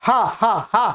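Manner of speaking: हा breathed out from the throat, repeated; हा voiced deep in the throat; throat h demo. Shown as a demonstration. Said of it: "हा का स्थान भी क्या है? कंठ".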